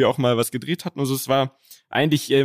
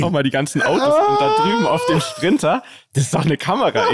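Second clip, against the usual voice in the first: alarmed excited; 5 semitones higher than usual. Retold or imitated. Friend alarmed, suspects hidden camera.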